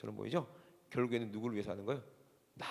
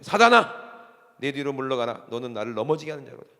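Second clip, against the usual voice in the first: Jesus rebuking; 11 dB louder than usual. Acted. Preacher acting Jesus rebuking Peter, stern and forceful. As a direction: thunder the first word, then order him away. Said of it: "예수님께서 뭐라고 그런 거예요?".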